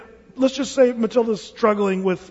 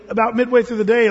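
ordinary talk, nothing marked

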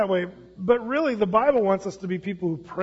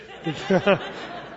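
laugh. Real, not acted. Laughs again at the story.

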